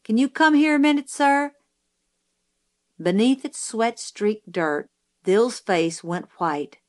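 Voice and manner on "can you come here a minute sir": high, quick call down the hall; higher-pitched, hurried summons; distant call. A narrator's reading. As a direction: call a parent in from another room. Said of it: "His voice was distant".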